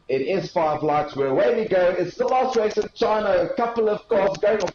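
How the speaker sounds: excited race start. Real, not acted.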